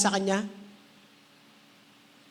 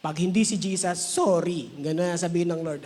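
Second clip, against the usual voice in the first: usual speaking voice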